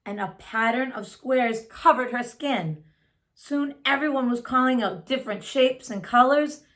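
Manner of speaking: plain reading voice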